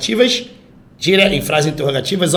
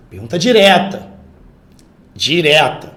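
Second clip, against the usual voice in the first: ordinary delivery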